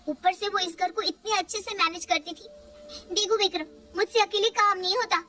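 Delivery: a worn-out wife begging her husband for help; complaining young wife. Acted.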